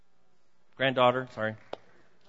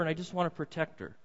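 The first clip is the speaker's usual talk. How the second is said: usual speaking voice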